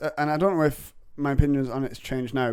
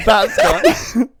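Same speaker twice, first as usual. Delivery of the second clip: louder, higher comic repeat; 8 semitones higher, 10 dB louder than usual. Shown as a demonstration. Comic repeating a punchline, louder and higher. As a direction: punch That's gone up and loud. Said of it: "a time that my dad disappointed me".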